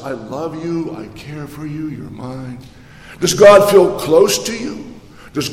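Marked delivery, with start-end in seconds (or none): 0.0-2.6 soft, falling to near hush; softly reassure, like holding someone; tender God. Pretend. Preacher voices a loving God to believers, tender and soft.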